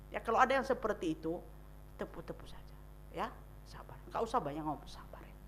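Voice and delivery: soft hushed whisper; whispered advice. Shown as a demonstration. Counselor whispering how to comfort a mourner. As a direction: hush and just pat them. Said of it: "advising quiet comfort".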